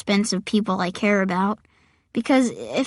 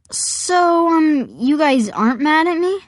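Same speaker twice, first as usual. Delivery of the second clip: higher, hesitant question; tentative; 6 semitones higher than usual. Acted. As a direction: ask timidly if you're forgiven. Nervous kid asks for reassurance.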